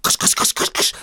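loud hissed cusses; a goat swearing in pain; loud hissing cuss noises; mock cursing.

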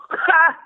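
high, loud burst of laughter; loud mock laugh. Comic laughing too hard at Seth's jokes, loud forced laugh. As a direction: fake an overeager cackle. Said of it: "I would laugh and go".

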